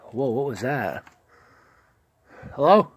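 normal talking voice

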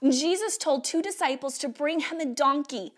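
ordinary talk, nothing marked

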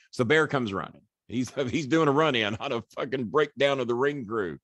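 normal talking voice

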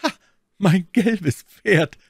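high, loud, drawn-out exclamation; joyfully surprised cry. A narrator's reading. D'Artagnan spotting the old yellow horse, crying out in joyful surprise. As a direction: cry out slowly in delighted amazement. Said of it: "stieß einen Schrei der Überraschung aus, der nicht frei war von einer Beimischung der Freude".

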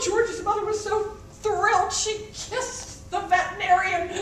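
ordinary delivery, nothing marked

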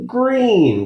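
high wailing cry of disgust; Kirsty over green shoes, high wail; wailing child. A narrator's reading. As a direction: wail the word in despair. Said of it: "Kirsty wailed".

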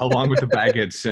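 repeats it with a laugh; chuckling echo of the joke; amused, laughing along. Real, not acted. Echoes guest's joke, laughing.

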